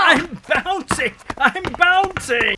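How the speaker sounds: high, flustered repeating; fussed and breathless. Acted. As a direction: bounce along in a fluster. Man reluctantly bouncing ball.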